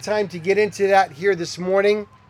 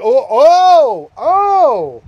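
higher, much louder startled "oh"s; startled gasps; 5 semitones higher, 9 dB louder, much slower than usual. Retold or imitated. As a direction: jolt back at the sight of the angel. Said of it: "And he's like".

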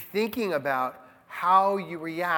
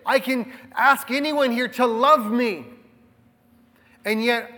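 ordinary delivery

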